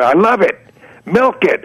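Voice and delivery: high, loud burst of delight; delighted yell. Retold or imitated. Client yelling his delight at the pitch. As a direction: shout it out, thrilled with the ad. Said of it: "he yells out".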